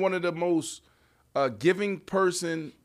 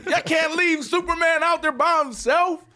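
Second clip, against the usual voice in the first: calling out; 10 semitones higher, 4 dB louder than usual. Real, not acted. Calling out teammates.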